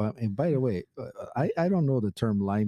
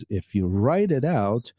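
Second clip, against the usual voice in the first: normal talking voice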